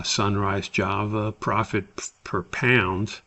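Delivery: ordinary talk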